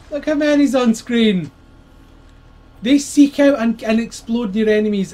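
loud, high, slow amazed yell; excited shout. Real, not acted. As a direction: shout it out in delight at the screen. Excited gamer shouting at screen.

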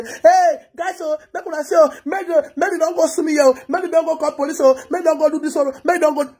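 high-pitched mock public appeal; mock shouted plea. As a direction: wail it out like begging a crowd. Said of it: "come, to come shout".

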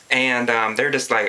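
ordinary talk, nothing marked

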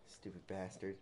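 normal talking voice

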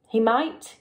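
usual speaking voice

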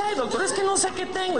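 high-pitched camp caricature; mock-effeminate whine; effeminate patient. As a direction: complain to the doctor in a high, fussy voice. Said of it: "llega un putito".

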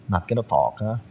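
ordinary delivery

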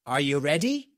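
ordinary delivery

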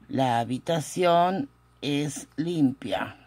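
slow, with pauses between word groups; slow dictation. Shown as a demonstration. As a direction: spell out the sentence word by word for note-takers. Teacher repeats translation slowly. (dictado lento y pausado)

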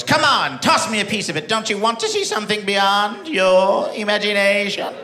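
mysterious pirate lady. Retold or imitated. Pirate lady tempting the comic for turkey: sly enticing voice. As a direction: lure him in like a sideshow mystic.

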